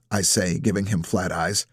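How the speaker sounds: usual speaking voice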